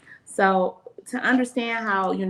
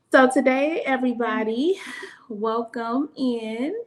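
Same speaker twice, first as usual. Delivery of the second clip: singsong welcome; 6 semitones higher, 3 dB louder than usual. Real, not acted. Co-host welcomes listeners to the show, singsong welcome. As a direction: stretch each phrase up in a welcoming lilt.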